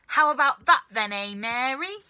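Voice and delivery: loud gloating shout. Acted. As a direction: crow it out at her. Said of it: "Grandma shouted".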